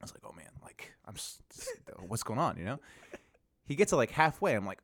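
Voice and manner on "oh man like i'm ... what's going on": near-whispered, low and halting; hushed retold worry. Storyteller watches the man cross toward him, hushed worry. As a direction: mutter it nervously to yourself.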